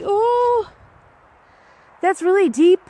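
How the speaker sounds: sudden high, loud "oh" of surprise; startled surprise. Real, not acted. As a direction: caught off guard, voice jumping up. Stepping into deep snowy mud.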